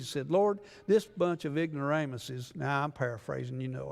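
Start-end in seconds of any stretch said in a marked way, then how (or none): none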